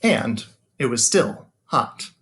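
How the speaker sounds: gentle storybook narration with long pauses; reading to children. A narrator's reading. Teacher reading a bedtime picture book to his students, storytelling voice.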